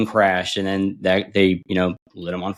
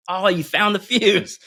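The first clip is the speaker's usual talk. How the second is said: higher, slow, sing-song innocence; mock innocent; 10 semitones higher, slower than usual. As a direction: feign surprise he found it, slowly and high. Teasing Marine about hidden fuse.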